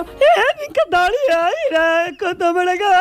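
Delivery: high, whiny, near-crying voice; weird voice note voice. Retold or imitated. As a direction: mimic a strange, off-putting voice message. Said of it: "o una voz".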